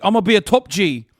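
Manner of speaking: loud, high, swaggering top G voice; puffed-up mock Taliban bro bragging to Ahmed; hammed-up Taliban hustler. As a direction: brag like a wannabe Andrew Tate. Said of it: "they're like".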